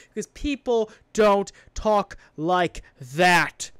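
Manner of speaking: loud, exasperated, drawn-out emphasis; pissed off. Real, not acted. Podcaster ranting about pretentious script dialogue, pissed off. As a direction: hammer out each word slowly in frustration. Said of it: "it pisses me off".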